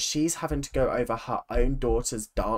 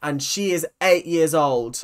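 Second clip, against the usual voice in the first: ordinary talk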